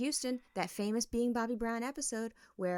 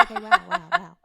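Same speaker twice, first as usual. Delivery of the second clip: loud, high, shocked "wow, wow, wow"; reeling back from being told to leave; stunned wow; 9 semitones higher, 12 dB louder than usual. Retold or imitated. Wife gets kicked out, loud high stunned wow. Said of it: "I was like".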